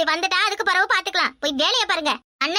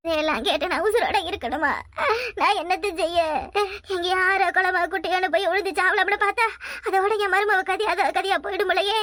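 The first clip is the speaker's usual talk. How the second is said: despairing lament. Acted. Mother bewails her fate and her daughter-in-law's, despairing lament. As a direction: wail it helplessly.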